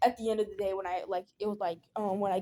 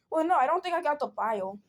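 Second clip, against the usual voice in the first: ordinary delivery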